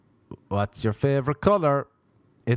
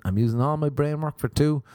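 normal talking voice

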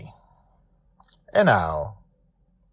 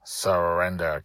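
plain reading voice

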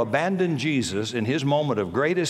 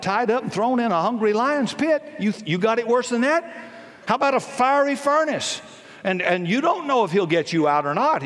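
full-force preaching; 8 semitones higher than usual. Acted. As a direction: thunder it out from the pulpit.

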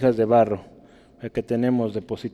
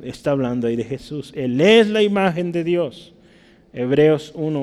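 ordinary talk, nothing marked

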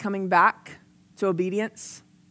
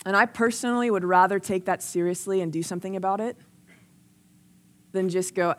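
ordinary delivery

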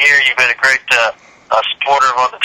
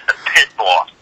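light New York twang on "the Pit Boss"; mild East Coast imitation; East Coast accent; 4 semitones lower than usual. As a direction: say "the Pit Boss" like a New Yorker, low and clipped-free. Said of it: "your East Coast accent comes out".

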